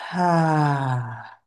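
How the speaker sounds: long open-mouthed exhale sigh; dramatic sigh. Shown as a demonstration. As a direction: let the breath out with a sigh. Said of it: "The exhale with a loud sigh".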